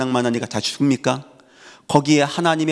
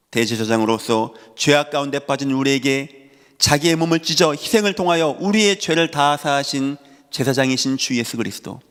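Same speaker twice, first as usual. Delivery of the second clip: ordinary talk, nothing marked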